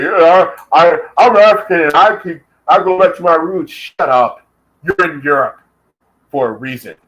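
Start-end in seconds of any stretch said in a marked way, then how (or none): 0.0-3.7 mock the roots claim in a put-on voice; mocking imitation. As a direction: ham up the stammering boaster. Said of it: "gonna come around us and say".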